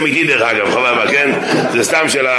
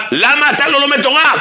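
1 yelling counter question; 12 semitones higher than usual. Retold or imitated. Turning the tables, yelling.

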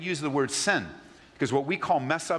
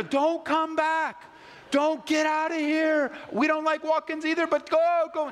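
high, slow, louder yelling; frantic shooing; 3 dB louder than usual. Pretend. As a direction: shoo it away desperately. Shooing the returning goat.

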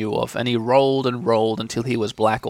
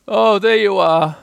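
slow, loud, higher call; raised storybook call from up high; 6 semitones higher, 8 dB louder, slower than usual. A narrator's reading. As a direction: hail someone below, drawing it out. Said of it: "called down Winnie the Pooh".

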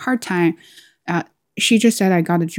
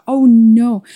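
worried oh no; 5 semitones higher, 8 dB louder than usual. Retold or imitated. Dread at HR call.

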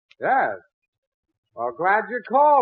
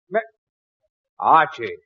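normal talking voice

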